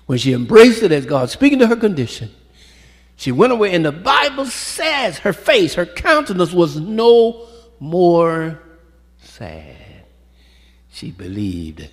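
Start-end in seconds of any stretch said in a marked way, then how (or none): 0.1-9.8 soaring sermon climax; preaching climax. Acted. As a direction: build to a triumphant peak.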